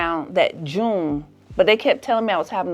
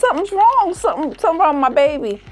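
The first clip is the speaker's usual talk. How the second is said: high, loud panicked cry to mom; 14 semitones higher, 6 dB louder than usual. Retold or imitated. Young mother-to-be shouts to Ma in panic, high and loud. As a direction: cry out to Ma in alarm, much higher.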